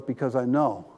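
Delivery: low, soft and intimate; hushed reassuring voice of God; God speaking tenderly. Retold or imitated. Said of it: "He goes".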